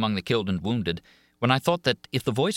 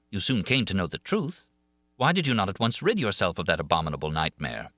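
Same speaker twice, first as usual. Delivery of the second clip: plain reading voice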